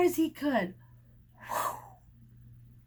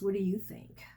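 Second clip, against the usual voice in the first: whisper; 4 semitones lower, 5 dB quieter than usual. Real, not acted. Asking child quietly.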